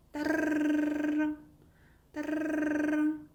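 "따르릉" as one long, high rolled r; sustained telephone-ring "따르릉" on one high pitch; phone-bell trill. Shown as a demonstration. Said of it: "전화벨 울리는 소리".

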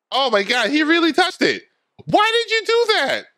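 very high, incredulous yelp; high-pitched shocked reaction; shocked outburst. Real, not acted. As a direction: squeal in disbelief at a stupid stunt. Reacting to touched electric fence.

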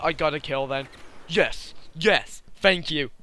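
ordinary talk

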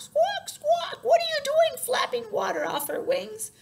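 very high, loud bird cries; squawking bird. A narrator's reading. Soaked bird scolding the elephant, squawking high and loud. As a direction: screech it like a bird that just got soaked. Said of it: "she squawked".

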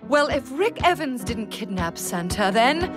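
stagey line trailing up, forgotten; stalling actress. Acted. Actress forgets her line mid-question, stalling. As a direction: deliver it, then hang on the last word.

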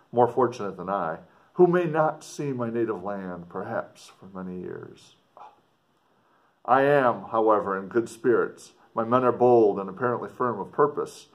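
put-on earnest, dramatic reading of an old letter; story narration. A narrator's reading. Explorer writing home to his sister, dramatic story reading. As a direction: read it like a gothic novel, with feeling. Reads letter three aloud.

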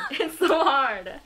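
laughing. Real, not acted. Laughing through complaint.

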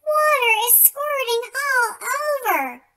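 usual speaking voice

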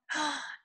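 mock-surprised gasp; excited gasp. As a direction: gasp with playful delight. Surprise at cat for children.

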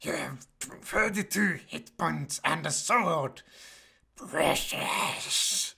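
slow creature voice ending in a hiss; retry the creature, hissing the last word; Gollum. Pretend. Second try at the creature guide, hissy impression. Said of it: "that doesn't sound like Gollum at all".

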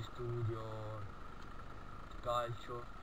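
ordinary delivery

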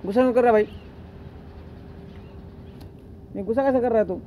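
high teasing scold; high scolding pet talk. Real, not acted. Scolding an angry monkey.

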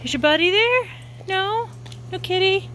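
very high, sing-song questions to a dog; pet talk. Real, not acted. Owner asking her dog about a kitty, high-pitched.

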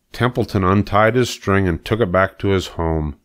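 ordinary delivery, nothing marked

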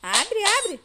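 high urgent plea; frightened plea. Real, not acted. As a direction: beg the door to open. Door won't open.

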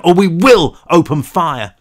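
loud, slow threat; police shout. A narrator's reading. Armed cop threatening the kids, slow loud shout. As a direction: warn them you'll fire. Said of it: "one of them shouted".

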